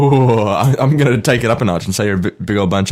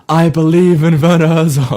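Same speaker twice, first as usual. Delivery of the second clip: proclaimed quote; 8 semitones higher, 5 dB louder than usual. Acted. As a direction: declare it like a grand slogan. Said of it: "And it said".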